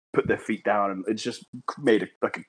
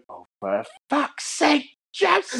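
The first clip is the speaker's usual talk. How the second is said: high, drawn-out shriek of a mum; hammed-up screeching mum impression; exasperated mum; 13 semitones higher, slower than usual. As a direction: wail it slowly like a horrified mother. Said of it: "And she went".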